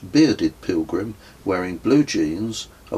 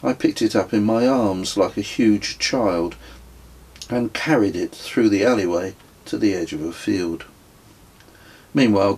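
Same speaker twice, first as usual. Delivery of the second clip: plain reading voice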